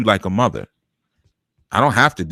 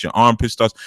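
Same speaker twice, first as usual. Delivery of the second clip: normal talking voice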